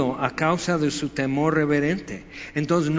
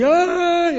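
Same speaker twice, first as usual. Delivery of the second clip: sharp, high, loud cry of agony; imitated cry of anguish, high and loud; 9 dB louder than usual. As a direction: let out a piercing "ay!". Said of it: "gritando así".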